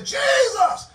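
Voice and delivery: high, loud cry to Jesus; ringing, high-pitched preacher's call. Acted. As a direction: cry out high for help from above. Calling out to Jesus.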